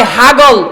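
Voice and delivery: "হাগল" with h for p, amused; নোয়াখালীর টানে "হাগল"; Noakhali dialect p as h. Shown as a demonstration. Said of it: "পাগলরে কয় হাগল".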